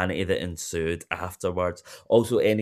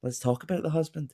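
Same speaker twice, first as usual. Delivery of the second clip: higher, pointed Housewives delivery; quoting a Housewife; 8 semitones higher, 3 dB quieter than usual. Retold or imitated. Host demonstrates her favourite quote, pointed and mock dramatic. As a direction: drop the line like a reunion bombshell. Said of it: "most usable quote".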